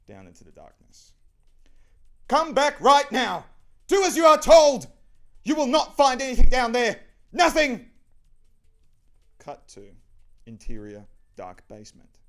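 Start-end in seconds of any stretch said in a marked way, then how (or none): 2.3-7.9 high, furious screamed orders; piercing, commanding full-force shout; shouting downstairs. Acted. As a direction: yell it down into the depths. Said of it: "She shouts down the stairs".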